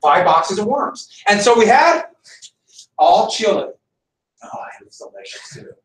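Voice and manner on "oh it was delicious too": sarcastic whisper. Real, not acted. Sarcastic aside about chili.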